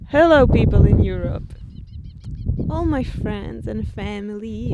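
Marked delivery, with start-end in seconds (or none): 0.1-1.5 loud greeting sent far away; calling out hello. Real, not acted. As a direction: shout hi across the distance. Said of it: "I'm gonna say hi to you".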